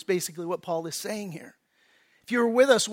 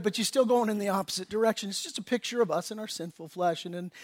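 ordinary talk, nothing marked

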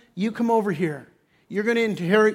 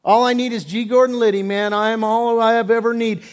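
loud, higher, cocky boast of a self-satisfied rich man; mock swaggering rich man; smug rich man; 4 semitones higher, 6 dB louder than usual. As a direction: brag like you need nobody. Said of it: "your attitude is".

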